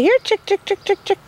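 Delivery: high, lilting "here chick" call; calling chickens. Shown as a demonstration. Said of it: "Call them. Say".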